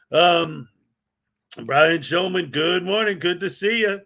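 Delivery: usual speaking voice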